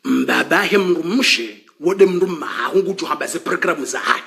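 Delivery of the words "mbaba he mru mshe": usual speaking voice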